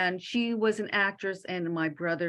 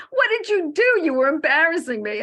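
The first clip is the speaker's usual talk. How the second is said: much higher, louder mock yelp; husband scolding; 8 semitones higher, 7 dB louder than usual. Husband at the wake, loud embarrassed rebuke. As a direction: squawk it like an embarrassed spouse. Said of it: "my husband was like".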